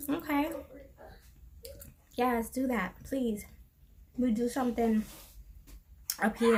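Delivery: ordinary delivery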